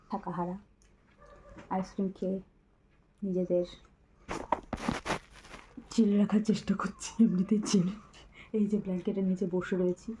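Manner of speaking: hushed late-night talk; speaking softly. Real, not acted. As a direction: keep your voice down, people sleep. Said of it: "আমরা এত আস্তে আস্তে কথা বলছি". (রাতে চাপা গলায় আস্তে কথা বলা)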